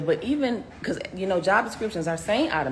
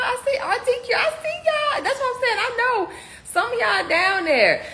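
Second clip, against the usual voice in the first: excited shout-outs; 12 semitones higher, slower than usual. Real, not acted. Shouting out her RTQ members in the live chat, high and hyped. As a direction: hype up the members you see.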